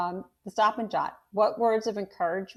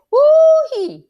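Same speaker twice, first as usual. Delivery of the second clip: high, loud whooping cheer; father cheering over the finished job; excited whoop; 19 dB louder than usual. Acted. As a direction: let out a big joyful woo-hee. Father celebrates finished work.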